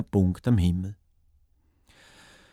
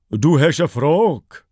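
slow, stately, louder delivery; dignified eagle; 4 semitones higher, 5 dB louder, slower than usual. A narrator's reading. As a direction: draw it out with grand dignity. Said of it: "sagt er würdig". (würdige Adler)

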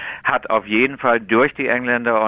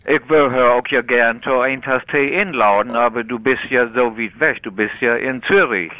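Dutch-sounding East Frisian Platt, slightly raised; East Frisian Low German; 3 semitones higher than usual. Shown as a demonstration. Tea expert invites Zurich host for tea, East Frisian Low German. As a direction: speak broad East Frisian dialect. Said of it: "tönt ein bisschen wie holländisch".

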